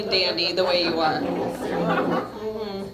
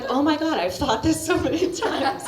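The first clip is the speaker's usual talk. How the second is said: laughing; 4 semitones higher than usual. Retold or imitated. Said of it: "I'm hoping you're thinking".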